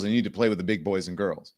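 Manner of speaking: normal talking voice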